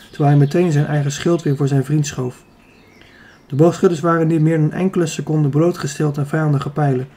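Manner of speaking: ordinary delivery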